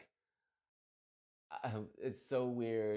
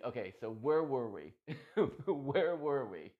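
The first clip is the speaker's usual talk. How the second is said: laughing it off; 3 semitones higher, 5 dB louder than usual. Retold or imitated. Retold coming back after crying.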